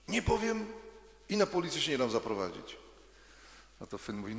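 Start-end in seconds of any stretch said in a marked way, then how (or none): 0.1-2.8 put-on high, stubborn child's voice; little Ania refusing, much higher and defiant; stubborn little girl. A narrator's reading. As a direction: dig in your heels like a small girl. Ania refuses a third time.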